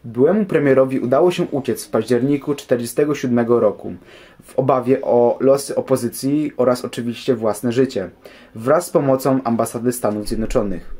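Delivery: normal talking voice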